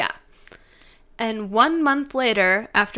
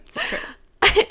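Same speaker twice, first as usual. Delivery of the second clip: giggly, a little quieter; softer laughing repeat; 10 semitones higher than usual. Real, not acted. [name] repeating her laughing admission. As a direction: concede it again, fading.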